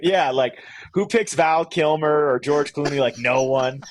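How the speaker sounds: ordinary delivery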